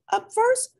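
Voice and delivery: high, loud call to the crowd; announcer. Acted. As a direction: call the first act up. Announcer calling the next contestant.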